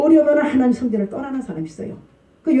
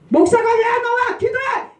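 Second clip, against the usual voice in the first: higher, sharp scolding call; scolding pastor; 11 semitones higher than usual. Retold or imitated. Pastor nagging a believer who skips church, higher scolding call. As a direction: nag the absent member to come pray.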